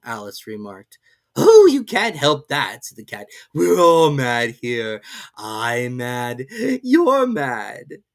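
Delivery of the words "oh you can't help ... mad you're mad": slow, drawling cat voice; Cheshire Cat. A narrator's reading. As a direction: toy with the girl lazily.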